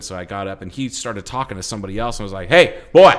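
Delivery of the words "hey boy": loud call across the room, higher. Retold or imitated. Friend calls to a coworker in the kitchen, loud summons. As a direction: shout for him to come along.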